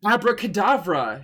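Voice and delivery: loud, high cast of a spell; yelled spell. A narrator's reading. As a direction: fling the spell out at them. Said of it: "he yelled at Snap".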